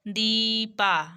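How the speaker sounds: दीपा with a long, held "dee"; teacher sounding out दीपा slowly; long vowel. Shown as a demonstration. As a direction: stretch the "ee" of दीपा. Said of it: "मतलब long sound based है ये".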